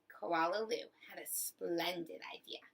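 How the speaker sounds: usual speaking voice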